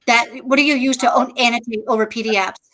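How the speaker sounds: ordinary delivery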